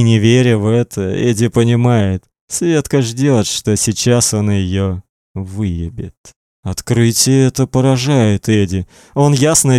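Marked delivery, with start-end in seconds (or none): none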